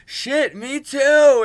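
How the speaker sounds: high, loud burst of agreement; excited exclamation. Retold or imitated. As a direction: blurt it out, thrilled. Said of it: "I was like".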